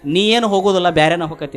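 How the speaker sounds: forceful, falling in pitch at the end; firm acted pronouncement. Sadhu tells Alexander he won't get home, firmly pronounced. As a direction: deliver the prediction with firm weight.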